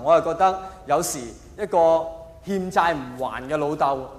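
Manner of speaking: ordinary talk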